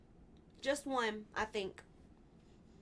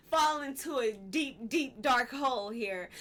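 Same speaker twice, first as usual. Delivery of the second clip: slow dramatic; 5 dB louder, slower than usual. Real, not acted. Dramatic despair image.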